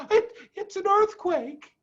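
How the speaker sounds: high, stammering alarm; mother fox alarmed in the shaking tunnel; frightened. A narrator's reading. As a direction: stumble over it in fear. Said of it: "said Missus Fox".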